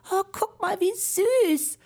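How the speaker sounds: put-on high, delighted girl's voice; girl cooing. [name] spotting a tiny spider, cooing in a high delighted voice.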